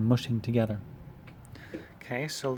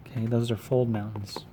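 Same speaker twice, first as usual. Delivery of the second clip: ordinary talk, nothing marked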